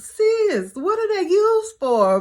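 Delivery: high, loud eager asking; excited high question. Retold or imitated. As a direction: pitch it way up, eager to know.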